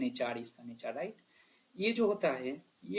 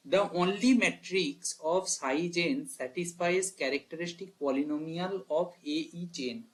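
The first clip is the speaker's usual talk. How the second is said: slow reading; much slower than usual. Shown as a demonstration. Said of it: "question का meaning समझना कितना important है".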